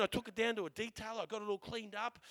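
normal talking voice

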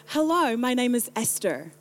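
ESTHER said American-style with a sounded R; slight American accent. Retold or imitated. Introducing herself to the next person, slight American accent. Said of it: "I changed my accent a little bit".

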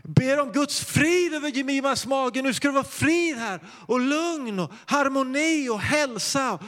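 high ringing cry of I Jesu namn; called out high. Retold or imitated. Pastor crying out to God over the baby, high call. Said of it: "så sa jag".

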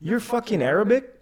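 high, incredulous quoted question; mock surprise. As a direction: act out the kid's amazement. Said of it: "He's like".

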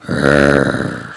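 very low rumbling growl; low creature growl. A narrator's reading. Hidden beast in the bush, growling. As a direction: growl from deep in the throat. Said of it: "And the bush replied".

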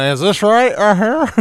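high, loud teasing imitation; mocking wife. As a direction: mimic her with a loud, high whine. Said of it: "She's like trying to".

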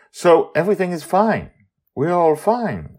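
Amali voice. Acted.

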